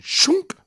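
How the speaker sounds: sharp high imitated thunk of a blade; knife impact sound. Telling a buddy how the falling knife landed, sharp imitated thunk. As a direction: spit out the sound of the knife hitting dirt.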